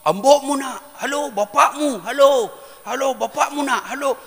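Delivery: mock phone yell; shouting into the phone. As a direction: holler down a dead phone line. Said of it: "Baru dia teriak".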